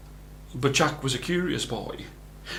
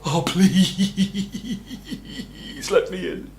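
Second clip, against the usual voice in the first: pleading Jack; 5 semitones higher, much slower than usual. A narrator's reading. Jack begging again.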